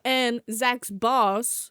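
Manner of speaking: ordinary talk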